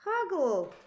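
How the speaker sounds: high, loud little cry for a hug; toddler asking for a hug. A narrator's reading. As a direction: arms out, a high happy call. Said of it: "and say".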